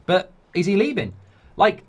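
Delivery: ordinary talk, nothing marked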